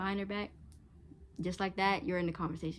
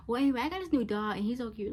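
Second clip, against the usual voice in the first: quick, higher, louder breezy brush-off; dismissive change of subject; 5 semitones higher, 3 dB louder, faster than usual. Retold or imitated. Friend brushing off someone's pain, mock breezy. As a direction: rush past their feelings like it's nothing. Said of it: "and be like".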